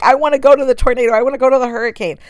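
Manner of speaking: mock eager weatherman. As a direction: rattle it off like a giddy kid begging to go. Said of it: "they're like".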